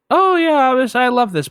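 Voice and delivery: high-pitched mock delight; blissful cat voice. Storyteller voices the cat loving on her, high and gushing. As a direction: put on a high voice for a pampered cat. Voicing the cat enjoying affection.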